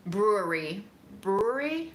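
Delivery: normal talking voice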